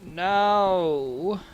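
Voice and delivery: normal talking voice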